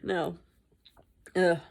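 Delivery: ordinary delivery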